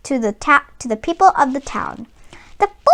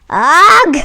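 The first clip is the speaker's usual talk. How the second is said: a higher, louder grunt of disgust; disgusted ugh; 4 semitones higher, 7 dB louder than usual. Acted. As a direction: spit it out in revulsion. Barkus pulls bootlace from teeth.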